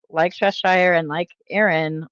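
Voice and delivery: ordinary talk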